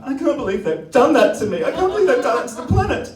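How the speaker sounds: high, loud mock-shocked whine; fake outrage. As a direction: play the wronged victim, hammed up. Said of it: "I'm like".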